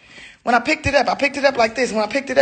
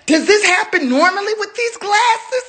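upset wailing; 8 semitones higher than usual. Real, not acted. Distraught over broken glasses.

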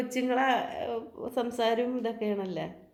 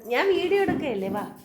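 higher, louder eager call; eager urging; 5 semitones higher, 4 dB louder than usual. Real, not acted. Mother filming, urging her child on, higher and louder.

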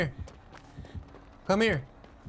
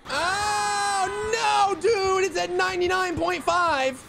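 frustrated shout; 11 semitones higher, slower than usual. Real, not acted. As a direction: yell in disbelief at the lost progress. Generator blown at 99.5.

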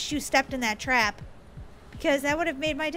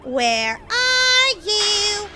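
higher, drawn-out taunting call; 4 semitones higher, much slower than usual. Pretend. [name] as Piggy hunts Daisy, taunting call. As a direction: call out teasingly, stretching the last words. Villain calls out for hidden player.

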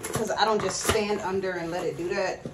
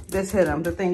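usual speaking voice

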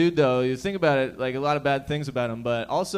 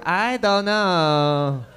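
very slow, drawn-out mock Chinese accent; 3 semitones higher, 4 dB louder, much slower than usual. Driver stonewalling the cop, slow mock Chinese accent. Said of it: "then they turned Chinese".

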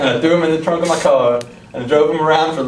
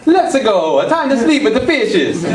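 cartoonish Super Mario Italian accent, high and bright; hammy video-game Italian, laughing through it; Mario-style Italian accent; 7 semitones higher than usual. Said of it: "the only Italian accent I know is from the Super Mario Brothers".

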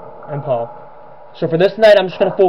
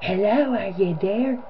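higher, softer, eerie rising call; soft demented woman voice; 4 semitones higher, 7 dB quieter than usual. Retold or imitated. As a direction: coo it softly like a creepy deranged woman. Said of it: "sounds like this".

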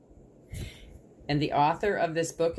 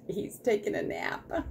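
whispering; 7 semitones higher than usual. Real, not acted. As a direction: whisper it, don't wake him. Whispering about sleeping dog.

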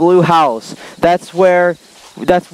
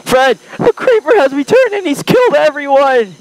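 panicked caller; 9 semitones higher than usual. Acted. Phone call voicing a panicked caller.

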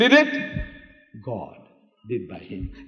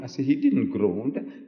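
usual speaking voice

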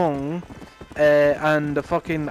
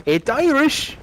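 high, hammed-up Irish accent; 10 semitones higher, 4 dB louder than usual. Mocking the video's barman, exaggerated Irish accent. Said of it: "in the most stereotypical Irish".

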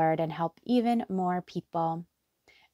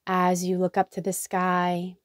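guided meditation. Acted. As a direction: lead them gently into relaxation, letting each phrase settle. Leads a guided hypnotherapy meditation.

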